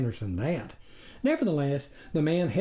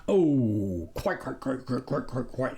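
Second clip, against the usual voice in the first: lightly voiced yapping stranger. A narrator's reading. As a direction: yap the quites like a dog. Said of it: "he yapped in return".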